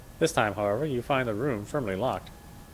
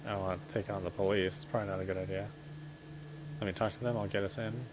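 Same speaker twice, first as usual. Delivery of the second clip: normal talking voice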